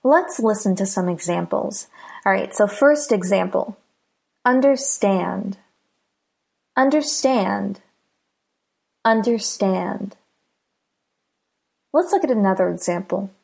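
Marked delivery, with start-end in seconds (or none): 4.5-10.1 "understand" with stress on the last part, "stand"; careful model of "understand", second part stressed. Shown as a demonstration. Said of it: "the stress is on the second part".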